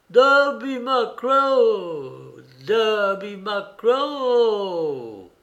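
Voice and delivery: high, very drawn-out ghostly call, repeated; wailing cry. A narrator's reading. Unseen voice haunting the pirates, long loud wail. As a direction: wail the name out, sliding down at the end. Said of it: "répété à dix reprises".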